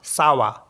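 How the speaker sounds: सावा run together as one word; French ça va. Shown as a demonstration. Teacher modeling French greeting for class. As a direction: say सावा smoothly, s at start. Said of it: "इसको बोलते हैं सा वा".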